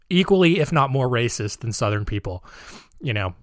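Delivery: ordinary talk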